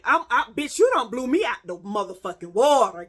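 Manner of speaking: ordinary delivery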